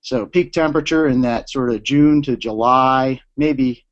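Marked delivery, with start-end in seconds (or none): none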